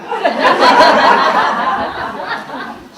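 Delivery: loud high burst of real laughter; hearty laugh. Real, not acted. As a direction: let out a big open laugh. Bursts out laughing at own story.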